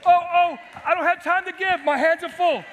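loud, high, strained pleading; overwhelmed cry. Acted. Preacher playing a worn-out believer, loud and high. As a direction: cry out under a crushing load. Acting burdened person crying out.

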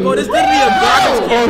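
high, loud disgusted yell; disgusted shout. Real, not acted. Pointing out bin in disgust.

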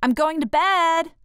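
loud, high shout of protest. Acted. Girl shouting to her parents she's going to bed. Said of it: "I shouted".

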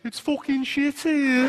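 slow, high, nasal Brummie moan; Birmingham accent. Shown as a demonstration. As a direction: drag it out in Brummie. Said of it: "The other phrase ... in Birmingham is".

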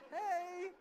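put-on high, loud four-year-old's reply; imitated kid's drawn-out hello; little boy's voice. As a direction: answer high like a small child. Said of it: "He's like".

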